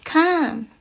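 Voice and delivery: a hint of the friend summoning Kes; mock beckoning call. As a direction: call him over coyly, in a mocking tone. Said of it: "calling my husband in the kitchen".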